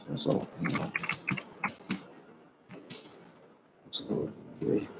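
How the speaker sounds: very soft, barely voiced mumble; muttering to self. Real, not acted. Speaker fiddling with a feed that won't close, muttering to self. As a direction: mutter faintly, off to yourself. Talking to self over failing controls.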